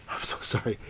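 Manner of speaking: breathy whispered sorry; whispered apology. Acted. Guest apologises again, whispering.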